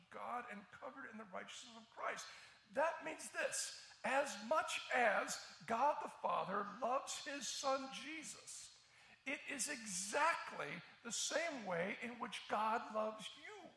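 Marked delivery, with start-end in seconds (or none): 2.7-13.7 louder, emphatic pulpit delivery; forceful preaching. Real, not acted. Pastor urging his congregation, forceful preaching. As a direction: press each point home to the crowd.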